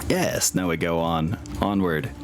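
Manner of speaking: ordinary talk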